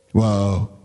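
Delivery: ordinary delivery, nothing marked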